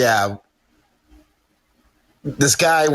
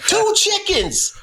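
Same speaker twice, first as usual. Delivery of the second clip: much higher, slow disbelief; incredulous; slower than usual. Retold or imitated. [name] repeating the chicken detail, high disbelief. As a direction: draw it out in shock. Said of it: "And I was like".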